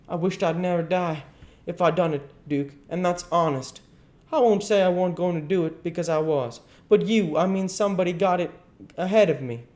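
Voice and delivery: high, earnest oath; protesting king. Acted. King swearing honesty to the duke, anxious protest. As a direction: plead innocence urgently.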